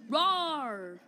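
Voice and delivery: loud, drawn-out pretend roar; play monster roar. Big monkey roaring back at attackers, loud pretend roar. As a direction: let out a loud mock roar.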